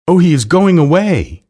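high, loud cry of dismay; close to tears. A narrator's reading. Miss Lark watching her dog leave, close to tears. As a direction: wail it as if about to cry. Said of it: "Miss Lark was going to cry".

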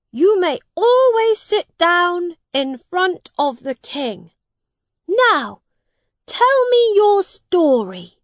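put-on high, commanding young prince; a boy playing king, granting leave; boy king granting a favour. A narrator's reading. Edward grants Miles his wish, high and regal.

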